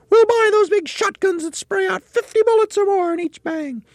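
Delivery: Missus Twit. Acted. Missus Twit squawking at her husband. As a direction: squawk like a harridan.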